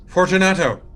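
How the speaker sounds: high, loud call into the dark; loud impatient call; calling aloud. Acted. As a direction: call his name through the wall. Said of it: "I called aloud".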